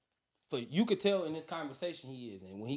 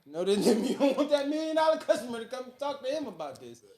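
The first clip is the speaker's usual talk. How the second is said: laughing; 5 semitones higher, 6 dB louder than usual. Real, not acted. Laughing through the story.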